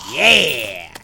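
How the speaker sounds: loud victory cheer; triumphant yell. Real, not acted. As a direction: cheer the kill. Celebrating the boss kill.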